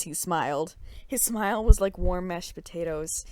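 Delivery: normal talking voice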